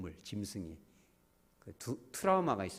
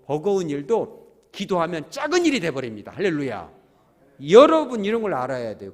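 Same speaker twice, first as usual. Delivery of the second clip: fiery preaching; 3 semitones higher, 9 dB louder than usual. Acted. Pastor exhorting the congregation in full preaching voice. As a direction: preach it out to the whole room.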